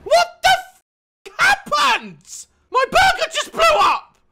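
shocked shout. Real, not acted. Gamer seeing his burger blow up, shocked yell. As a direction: yell in disbelief.

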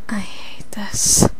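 slow, hushed, whispery grumble; mumbled complaint. Acted. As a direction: mumble it under your breath. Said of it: "bitches don't mumble".

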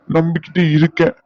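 ordinary talk

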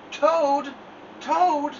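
high, loud calling; crying out. A narrator's reading. Frog calling into Toad's house, crying out. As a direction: cry out his name. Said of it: "cried Frog".

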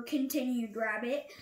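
plain reading voice

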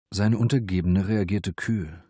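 ordinary delivery

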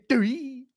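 high mock cry breaking into a laughing shout; the kid getting hit, laughing it out; cartoon yelp then crying laugh; mock hurt cry. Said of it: "He just goes in there".

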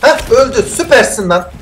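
usual speaking voice

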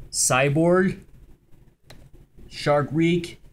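ordinary talk, nothing marked

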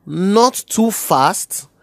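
deliberate, phrase by phrase; slow instruction. Acted. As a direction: spell it out slowly for the boy. Instructs boy how to count.

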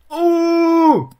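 high loud surprised cry; excited shriek. Real, not acted. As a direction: cry out in joy. Cap landed.

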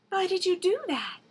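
high, puzzled princess voice; cartoon princess. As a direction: ask it like a wide-eyed princess. Princess asks the demon.

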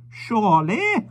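put-on high, very slow old man; musing old gentleman, drawn out; slow, high elderly voice; old stranger's voice. Old stranger remarking on the wife.

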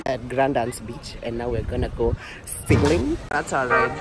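ordinary delivery

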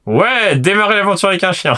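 high, loud excited yell; excited shout. Real, not acted. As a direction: shout it with glee. Excited about starting with dog.

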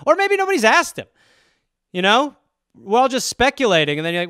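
normal talking voice

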